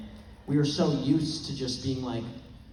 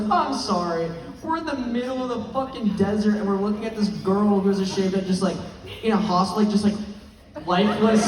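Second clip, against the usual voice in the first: exasperated costar rant; 6 semitones higher, 6 dB louder than usual. Retold or imitated. Eduardo's exasperated rant retold.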